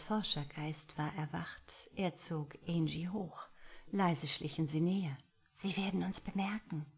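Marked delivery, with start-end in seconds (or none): 5.5-6.9 whispered worry; sighing. A narrator's reading. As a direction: Angie warns Anton in a sighing whisper. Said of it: "seufzte Angie".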